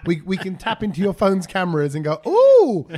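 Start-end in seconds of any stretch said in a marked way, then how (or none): 2.3-2.9 high, loud, hammed-up ooh; mock peeping surprise. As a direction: swoop up loudly as if catching someone out. Playing someone spying through phone cameras.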